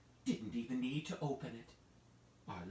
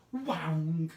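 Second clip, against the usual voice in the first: loud whang; 3 semitones higher, 8 dB louder than usual. A narrator's reading. Storyteller making the oven door bang open. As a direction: punch out the clang of the door.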